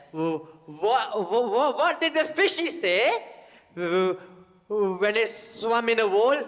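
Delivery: put-on high, slow, stumbling child voice; imitating little kid joke. Comic as nephew telling Johnny's joke, high. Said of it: "Johnny said".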